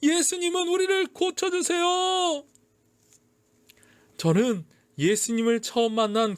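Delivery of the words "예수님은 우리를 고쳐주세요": high, exultant calling out; fervent proclamation. A narrator's reading. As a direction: call out the good news to a crowd. Healed man proclaims Jesus to people. (사람들에게 힘차게 전하는 외침)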